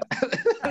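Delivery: a high burst of laughter; laughing. Real, not acted. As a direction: laugh at his own joke. Joking about being seventeen.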